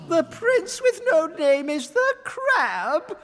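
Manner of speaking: high, loud, slow outraged question; shocked queen shouting. Acted. Queen shouts at the crab reveal, high, loud and outraged. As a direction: shout it in disbelief. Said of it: "shouted the queen".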